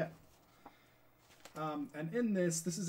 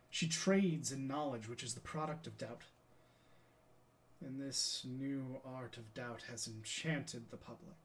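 Galileo, reflective. Acted. Galileo on science and the wool trade, theatrical and reflective. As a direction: reason it out like a weary lecturer on stage.

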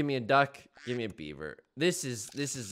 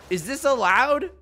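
high, loud incredulous question; incredulous protest; 9 semitones higher, 7 dB louder than usual. Real, not acted. Gamer protesting the enemy peacock, incredulous. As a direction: question it in loud, rising disbelief.

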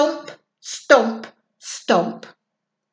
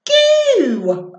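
a sudden high squeal on goo; high squeaky goo; 9 semitones higher, 3 dB louder than usual. Acted. Reading to a child, squeaky high goo.

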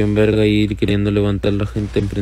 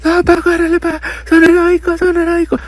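panicked teammate warning; 5 dB louder than usual. Retold or imitated. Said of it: "el vato me decía".

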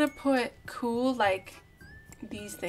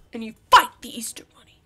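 playful whisper; 7 dB louder, faster than usual. Pretend. Playful sign-off.

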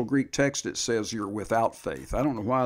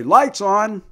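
casual voice command; 9 semitones higher, 10 dB louder than usual. Shown as a demonstration. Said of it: "You just say".

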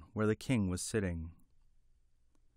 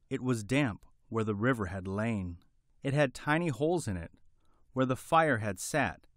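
ordinary delivery

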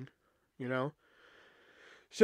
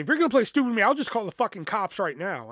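higher, louder retold ultimatum; angry threat; 5 semitones higher, 3 dB louder, faster than usual. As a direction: imitate an angry mother laying down a threat.

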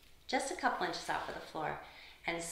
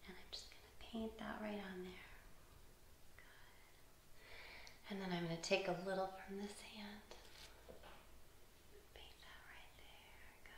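very soft, near-whispered murmur; gentle whispery play-by-play; hushed, soothing; 15 dB quieter than usual. Real, not acted. Quietly painting a child's forehead.

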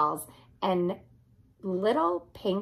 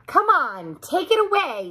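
loud excited yell up high; 8 semitones higher, 4 dB louder than usual. Acted. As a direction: call out to the cat, fired up. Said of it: "yelled little cat A".

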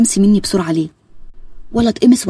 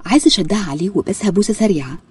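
ordinary delivery

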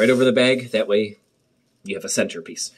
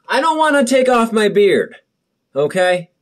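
high, loud whiny protest; nervous plea; 10 semitones higher, 5 dB louder than usual. Real, not acted. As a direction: wail that you don't want to lose the beard. Dreads shaving his beard.

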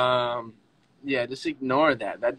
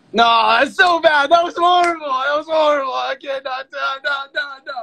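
high, loud embarrassed wail; mortified outburst; 11 semitones higher, 7 dB louder than usual. Real, not acted. Streamer appalled by own accent attempt, mortified high wail. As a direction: wail in embarrassment, fast repeats tumbling out.

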